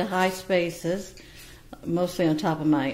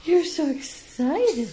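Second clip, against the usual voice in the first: higher and slower, drawn out to the bird; high slow pet talk; 9 semitones higher, slower than usual. Real, not acted. Owner cooing at excited Beauty, high slow pet talk. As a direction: coo it slowly up high.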